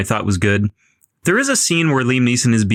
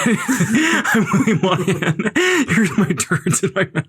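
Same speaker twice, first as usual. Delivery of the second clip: laughing; 7 semitones higher, slower than usual. Pretend. Mocking the writer, still laughing.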